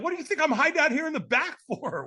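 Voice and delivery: high-pitched, amused disbelief; incredulous retort. Retold or imitated. Man hiding at the back answers a stranger, incredulous retort.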